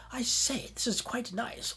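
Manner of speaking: high-pitched posh British voice; posh British accent. Shown as a demonstration. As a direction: put on very posh British English. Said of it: "a British accent, a very posh".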